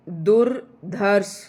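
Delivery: दुर्धर्ष dictated slowly to the class; slow careful pronunciation. Shown as a demonstration. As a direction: stretch दुर्धर्ष out, dur-dharsh, very slow. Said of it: "तो ये ध्यान से देखे दु".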